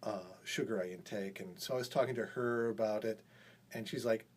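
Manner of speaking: ordinary delivery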